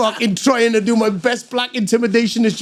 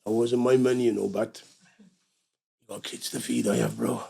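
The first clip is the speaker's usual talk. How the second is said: low, soft threat; low menacing; 8 semitones lower, 8 dB quieter than usual. Retold or imitated. The buyer to the narrator, lower and softer menace. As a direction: lean in and threaten quietly. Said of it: "he goes".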